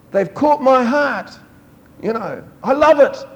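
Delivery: put-on a delighted father catching his child; God overjoyed. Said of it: "And he says, like".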